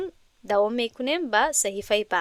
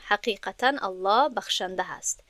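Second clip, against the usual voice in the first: ordinary delivery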